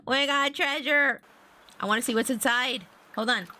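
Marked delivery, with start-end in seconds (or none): 0.1-1.2 slow, high, triumphant repeat; excited crowing. Real, not acted. Player celebrating the treasure, triumphantly excited. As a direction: crow it out, stretching the words.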